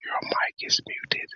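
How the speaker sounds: breathy stage whisper. Real, not acted. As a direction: whisper it across the room. Signalling muted guest silently.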